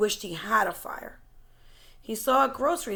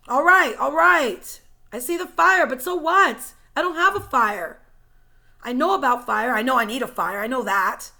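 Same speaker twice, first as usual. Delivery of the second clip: frustrated anger; 9 dB louder than usual. A narrator's reading. Brian snapping at the dream, frustrated and loud. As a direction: spit it out, fed up and angry. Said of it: "his frustration and his anger rise".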